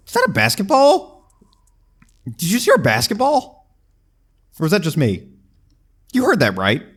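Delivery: high, loud alarmed questions; suddenly spooked by a sound; startled. Real, not acted. Startled by a noise in game.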